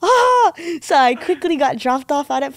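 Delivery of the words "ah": high, loud excited shriek; retold scream of delight; excited squeal. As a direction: let out a high squeal of joy. Retold joy at permission to surf.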